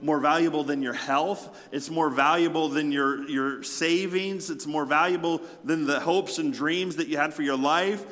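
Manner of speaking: forceful sermon climbing in pitch; impassioned preaching. Real, not acted. Preacher urging the church how valuable the gospel is, impassioned and building.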